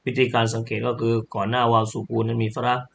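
normal talking voice

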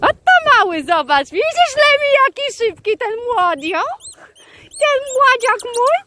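very high, excited gushing; squealing delight. Real, not acted. Zachwyca się szybkim młodym psem. (piskliwy zachwyt nad młodym psem)

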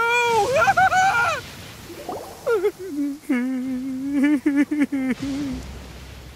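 nervous laugh. Acted. Flustered laugh after beans.

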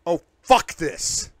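slow, loud raging curse. Acted. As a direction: throw up your hands in rage. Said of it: "I rage at the world".

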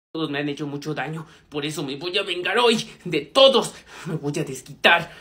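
low, menacing revenge mutter; dark villain voice. Acted. Brooding revenge monologue.

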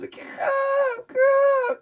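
very high squeaky caws; tiny eaglet caws. Acted. Newborn eagles calling for food, very high caws.

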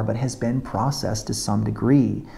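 ordinary talk, nothing marked

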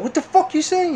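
high-pitched retold outrage; pissed off. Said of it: "he was pissed off".

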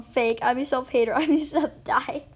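usual speaking voice

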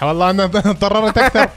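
ordinary talk

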